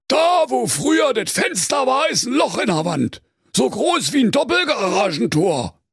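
high, emphatic Berlin detective; excited cop calling out findings; sleuthing commissioner. A narrator's reading. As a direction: proclaim the clue at high pitch.

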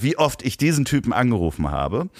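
ordinary talk, nothing marked

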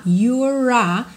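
"You're a" with a rolled R running into "a"; rolled R link. Shown as a demonstration. Teacher modelling the linked rolled R. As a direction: roll the R of "You're" straight into "a". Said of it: "com o R enroladinho".

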